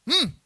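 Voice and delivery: high, loud falling hum; wordless signal. Retold or imitated. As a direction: grunt a summons without speaking. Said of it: "dia cuma pakai bahasa isyarat". (dengungan isyarat tanpa kata)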